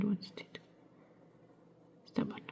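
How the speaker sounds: quick frustrated whisper. Real, not acted. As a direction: hiss it under your breath at the bead. Bead refuses to sit.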